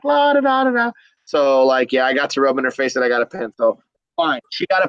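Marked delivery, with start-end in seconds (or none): 0.0-0.9 high, held singsong "la da da"; showing-off taunt. Retold or imitated. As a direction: gloat it in a high teasing singsong. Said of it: "showing off to her like".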